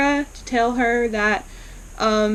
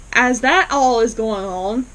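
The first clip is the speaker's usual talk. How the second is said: ordinary talk, nothing marked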